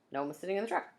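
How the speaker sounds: ordinary delivery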